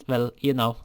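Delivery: usual speaking voice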